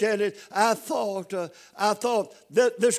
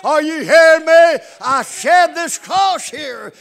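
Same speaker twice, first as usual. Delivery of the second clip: much higher, much louder call to the congregation; fiery revival cry; preaching shout; 7 semitones higher, 12 dB louder than usual. Real, not acted. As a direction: shout it out to the church, slowing down. Preacher at full force.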